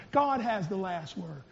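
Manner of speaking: low murmur to a neighbour; quiet share. Shown as a demonstration. Congregant to neighbour, soft murmur. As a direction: say it softly to the person beside you. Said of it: "but just share".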